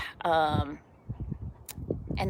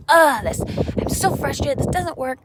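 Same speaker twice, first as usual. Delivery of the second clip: higher, louder hammed-up exasperated groan; mock frustration; 8 semitones higher, 4 dB louder than usual. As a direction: act out a fed-up outburst. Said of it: "get a little bit frustrated and mad".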